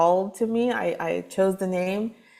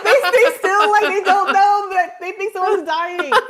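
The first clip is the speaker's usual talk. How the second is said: laughing; 12 semitones higher, 6 dB louder than usual. Real, not acted. Laughing about the neighbours.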